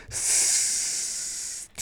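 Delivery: soft, drawn-out creature hiss; long hiss. A narrator's reading. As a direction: hiss long through the teeth. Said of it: "zischte Gollum". (langes, leises Zischen)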